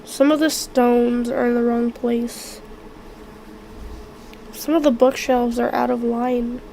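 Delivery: ordinary talk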